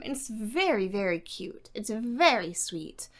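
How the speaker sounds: ordinary delivery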